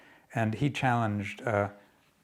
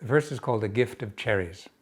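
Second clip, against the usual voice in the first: ordinary delivery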